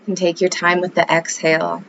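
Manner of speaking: calm yoga-teacher voice; guided meditation. Acted. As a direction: ease the listener into the breath, unhurried.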